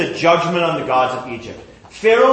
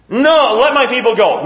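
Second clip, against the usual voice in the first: firm demand; 6 semitones higher, 4 dB louder than usual. Acted. Moses demanding.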